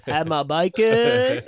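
slow, drawn-out stoner surfer; stoner surfer guy. Retold or imitated. Host mimicking the caller's breakfast answer, stoner surfer drawl. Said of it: "a stoner surfer guy".

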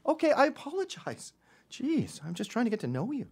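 high-pitched wounded innocence; faux-offended retreat in a high voice; mock-hurt apology. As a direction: back off, acting hurt.

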